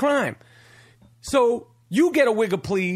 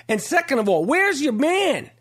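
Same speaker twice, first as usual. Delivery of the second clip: ordinary talk, nothing marked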